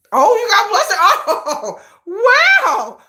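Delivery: high, loud squeal breaking into laughter; giddy, flustered. Real, not acted. Teasing herself over a crush, giddy and flustered. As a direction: gush as if caught out by a crush.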